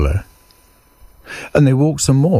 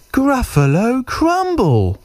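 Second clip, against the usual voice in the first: high, drawn-out mock threat falling at the end; tiny mouse savouring GRUFFALO CRUMBLE slowly; menacing little mouse; slower than usual. Mouse names his favourite food, slow high threat.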